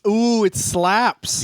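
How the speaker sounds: slow, high drawn-out ooh; mock impressed. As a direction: savour the slang mockingly. Echoing slang mockingly.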